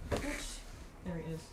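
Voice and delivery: very soft, drawn-out murmur; quiet remark. Real, not acted. Finds it, says it low first.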